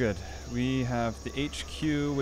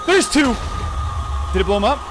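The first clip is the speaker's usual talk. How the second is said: alarmed; 9 semitones higher, 9 dB louder than usual. Real, not acted. Sudden extra creeper.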